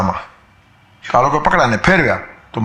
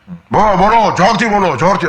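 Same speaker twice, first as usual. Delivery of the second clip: mock crowd shouting; 7 semitones higher than usual. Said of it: "પણ લોકો રાડો જ પાડતા હોય".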